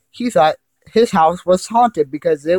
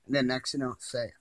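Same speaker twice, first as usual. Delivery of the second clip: usual speaking voice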